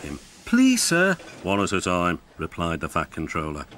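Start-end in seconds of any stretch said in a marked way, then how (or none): 0.4-1.2 high, eager plea; eager high-pitched request; pleading. A narrator's reading. Engines plead for Controller's attention. 1.4-2.2 low, measured authority; lightly voiced boss; stern controller. A narrator's reading. Fat Controller checks eager engines.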